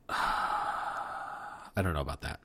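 mock-weary, breathy near-whisper, slow; reluctant whisper. Simba shrugging off Nala's plea, weary whisper. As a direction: sigh it out as if you can't be bothered.

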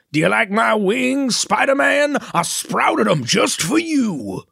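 high, slow, taunting villain voice; hammed-up Goblin showing off his wings; gloating Green Goblin. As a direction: purr it at Spider-Man as a smug villain. Green Goblin line quoted.

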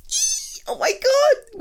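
high, loud excited squeal; thrilled high squeal; squeal of delight. Acted. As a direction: squeal with joy at the news. Hears partner won.

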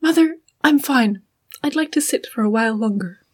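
lightly voiced dutiful daughter; a hint of a put-on polite character voice; Yanli. Yanli answering her mother at the coffin, lightly acted. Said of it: "Can I, can I be Yanli?".

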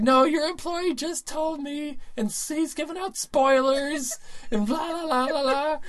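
high, slow mock rant; hammed-up wail, drawn out; whining complaint. Imitating prank victim telling on employee, high drawn-out whine.

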